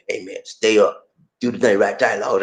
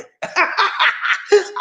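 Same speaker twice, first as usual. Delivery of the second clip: high, rapid cackle; long high-pitched giggle; laugh; 13 semitones higher than usual. Real, not acted. Laughs after voiced greeting.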